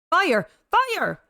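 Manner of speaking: high, sharp cry of fire; urgent high-pitched shout; yelled alarm. Acted. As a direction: yell a warning to the crowd. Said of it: "somebody else yelled fire".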